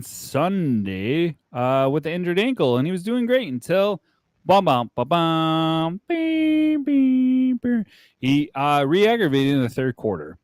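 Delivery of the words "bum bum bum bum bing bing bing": imitated jingle noises. Doc interrupting his injury report, mock musical sound effect. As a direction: sound out bums leaping up to ringing bings.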